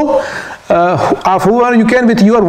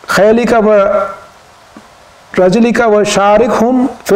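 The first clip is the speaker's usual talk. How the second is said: slightly raised, quickening Arabic recitation; Quran recitation; 3 semitones higher, much faster than usual. Acted. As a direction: recite the verse in a higher, even tone.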